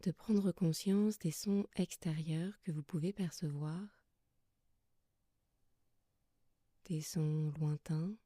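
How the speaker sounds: soft, calm yoga nidra guidance with long pauses; guided meditation. Acted. Yoga teacher settling listeners lying under blankets, soft guided meditation. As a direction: guide them gently into rest, leaving long silences between phrases.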